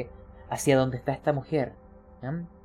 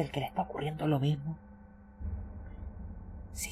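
hushed murmuring; 10 dB quieter than usual. Acted. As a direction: murmur it so nobody overhears. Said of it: "con la voz baja para que no los escuchen".

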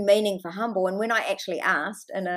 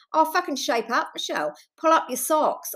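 higher-pitched mocking impression of a scolding critic; a dismissive critic telling her to toughen up; 6 semitones higher than usual. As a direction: sneer it like an impatient know-it-all. Mocking dismissive people quoted.

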